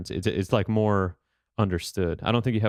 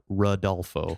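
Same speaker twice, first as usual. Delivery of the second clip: American accent; slower than usual. Shown as a demonstration. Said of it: "in the most American way possible".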